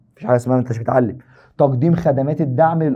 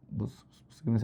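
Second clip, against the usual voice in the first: whisper; 4 semitones lower, 14 dB quieter than usual. Real, not acted. Teacher grabs class attention quietly.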